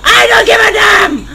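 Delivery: high shouted defiance in panic; panicked shouting. Real, not acted. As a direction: shriek to drive off an attacker. Fighting off game attacker.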